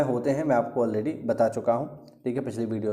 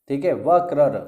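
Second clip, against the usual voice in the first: ordinary talk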